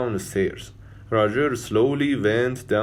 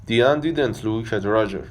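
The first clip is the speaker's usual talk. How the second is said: ordinary delivery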